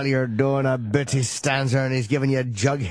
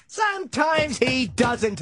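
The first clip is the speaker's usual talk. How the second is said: slow, pitched-up hammy sea captain; overdone Quint; 9 semitones higher, much slower than usual. Shown as a demonstration. Overdoing Quint's line for laughs, slow and high. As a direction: drag it out like a ham actor. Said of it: "I would overdo it".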